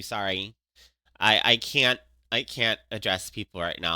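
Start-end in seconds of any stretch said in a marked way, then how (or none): none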